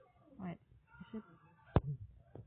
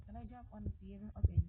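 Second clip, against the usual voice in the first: ordinary talk, nothing marked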